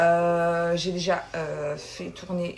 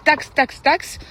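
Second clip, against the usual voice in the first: higher, louder call of taxe; shouted hawker call; 7 semitones higher, 6 dB louder, much slower than usual. Retold or imitated. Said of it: "Ils vont vous crier".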